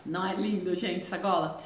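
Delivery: usual speaking voice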